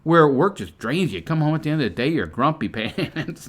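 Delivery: ordinary talk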